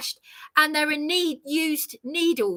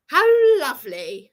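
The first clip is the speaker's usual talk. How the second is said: bitter laugh; 4 semitones higher, 6 dB louder than usual. Real, not acted.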